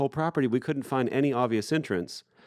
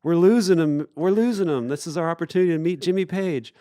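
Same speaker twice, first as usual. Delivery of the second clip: high-pitched mock panic; imitating freaking out; 7 semitones higher, 3 dB louder than usual. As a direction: act out a friend's panicky plea. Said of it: "Edwin starts freaking out".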